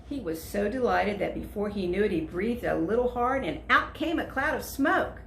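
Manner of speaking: ordinary delivery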